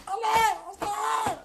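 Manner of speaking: high wailing cry. Real, not acted. Railing at the so-called Shia, a high wailing cry.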